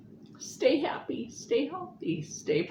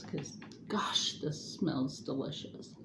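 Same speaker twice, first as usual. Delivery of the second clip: whispering; 4 semitones lower, 8 dB quieter than usual. Real, not acted. Leaning in to smell the food.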